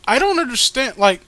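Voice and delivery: ordinary talk, nothing marked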